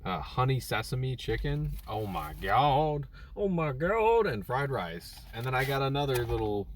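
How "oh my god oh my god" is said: rising squeal of delight; excited. Real, not acted. Excited about food.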